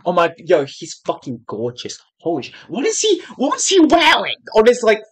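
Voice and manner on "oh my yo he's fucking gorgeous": ordinary talk, nothing marked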